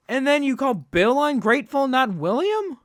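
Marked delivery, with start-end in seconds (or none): none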